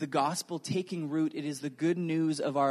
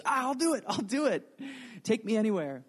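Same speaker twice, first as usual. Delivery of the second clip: eager volunteer; 6 semitones higher than usual. Retold or imitated. Isaiah eagerly accepting.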